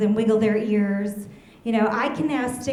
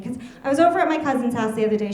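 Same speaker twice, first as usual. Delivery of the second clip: ordinary talk, nothing marked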